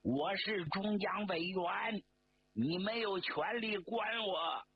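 慢而高的怒吼; slow, high angry roar. A narrator's reading. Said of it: "吼叫着对周恩来说". (被押前怒吼抗议)

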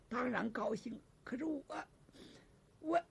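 hushed, halting near-crying voice; acted tearful. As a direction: play her choking up softly. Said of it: "别哭".

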